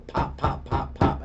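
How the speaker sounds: four crisp, punched pops; imitated gunshots. Witness tells police what he heard, imitating gunshots. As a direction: pop out imitation gunfire, evenly spaced.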